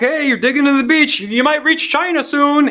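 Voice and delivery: mock playful adult; teasing joke voice. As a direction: tease a kid at the beach. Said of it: "People say that as a joke".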